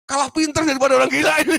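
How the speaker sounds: very high, loud, fast rebuke; preaching rebuke. Real, not acted. The preacher scolds the congregation, loud and high. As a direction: scold the church with full force. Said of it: "preacher rebukes congregation".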